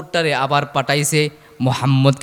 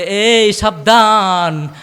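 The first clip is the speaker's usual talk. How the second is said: high, loud, long-drawn warning; stern warning; 5 semitones higher, 5 dB louder, much slower than usual. Acted. Lord above warning the dog, drawn-out raised warning.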